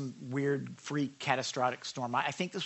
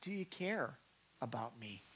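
softer, small doubting plea; 4 semitones lower, 14 dB quieter than usual. Retold or imitated. The disciples asking Jesus if he cares, softly. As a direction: ask it quietly, almost hurt. Preacher voicing a doubting prayer.

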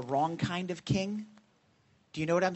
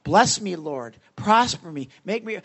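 louder greedy demanding prayer; imitating a self-serving churchgoer's prayer, louder; mock selfish prayer; 8 dB louder than usual. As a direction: beg like a spoiled child. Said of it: "everybody wants something for themselves".

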